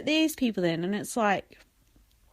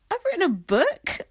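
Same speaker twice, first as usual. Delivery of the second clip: lightly voiced pompous author boast, pitched higher; a hint of self-important bragging; smug Andy West; 10 semitones higher, 3 dB louder than usual. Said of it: "I sound like Andy West now".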